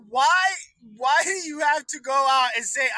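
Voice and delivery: usual speaking voice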